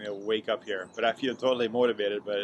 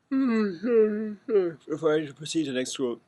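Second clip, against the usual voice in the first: words stretched through a yawn; 8 semitones higher, 4 dB louder, much slower than usual. Real, not acted. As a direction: talk mid-yawn, slow and drawn out. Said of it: "yawn like this".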